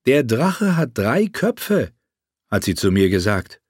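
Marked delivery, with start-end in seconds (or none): 0.1-1.9 higher, slow, distant queen; lightly voiced queen, measured; queen's voice. Quoting Daenerys. (langsam, höher gesprochene Königin)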